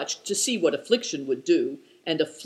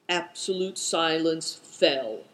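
plain reading voice

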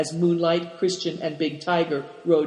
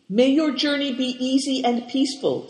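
farewell pitched higher, youthful; 8 semitones higher than usual. A narrator's reading. Boys wishing the herdsman well, higher voiced. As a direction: sing out the good wishes.

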